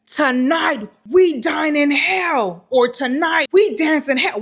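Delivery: a hint of a warrior's war cry, slow and drawn out; lightly mimicked battle-cry declaration; movie line. Quoting a movie hero before the kick, mock-epic. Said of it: "whatever that man said in that movie".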